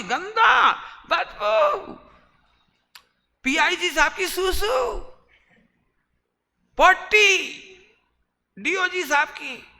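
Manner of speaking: high, loud, exaggerated yuck voice; mock disgust. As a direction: squeal it like dirt repels you. Mock disgust at dirt, teasing.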